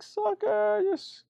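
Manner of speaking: high-pitched mocking "Sucker"; gleeful falsetto taunt; jeering guards. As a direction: jeer it high after someone leaving. Guards jeering once he left.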